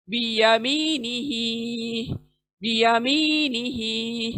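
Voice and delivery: बियमीनिही with या and ही long, नी quick; slow tajweed reading of बियमीनिही; drawn-out recitation. Shown as a demonstration. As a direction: stretch या and ही in बियमीनिही. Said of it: "नी को fast और ही को खींचना है".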